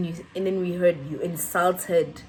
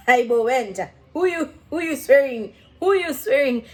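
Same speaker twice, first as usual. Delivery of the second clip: loud, higher mock outrage; indignant retold challenge, pitched up; indignant comeback; 3 semitones higher, 5 dB louder than usual. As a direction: act out a heated comeback. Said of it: "we are swearing this guy".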